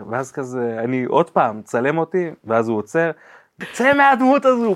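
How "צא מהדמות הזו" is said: sudden much higher, louder retold yell; photographer's shout. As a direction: throw it out like a fed-up photographer cutting the take. Photographer stops shoot, shouts.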